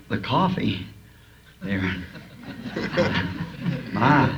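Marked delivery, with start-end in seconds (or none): 2.5-3.9 quiet breathy chuckle at his own joke; soft chuckle. Real, not acted. Preacher chuckling at his own coffee joke. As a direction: let a little laugh slip out.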